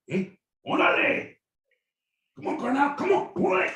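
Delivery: high, loud mock Mexican soldier; rallying cry. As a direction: fire up the troops, calling out.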